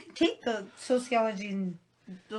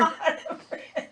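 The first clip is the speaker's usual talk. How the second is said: laughing; 7 semitones higher, 3 dB louder than usual. Real, not acted. Laughing reply.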